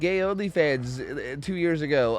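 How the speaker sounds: hammed-up shocked gossip; mock gushing fan. Mocking excited Twitter reactions.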